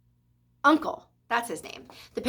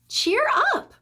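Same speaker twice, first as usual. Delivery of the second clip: cheering up. A narrator's reading. Mother cheers up a frightened friend.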